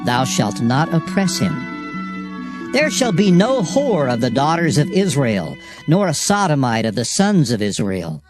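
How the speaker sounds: ordinary talk, nothing marked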